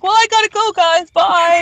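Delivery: high, chirpy fake-polite farewell; airy mock politeness; sing-song goodbye. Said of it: "And then I was like".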